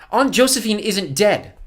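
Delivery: high, loud, slow insistence; insistent boy. Acted. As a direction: spell it out loudly, pitched high. Boy insists aunt lives.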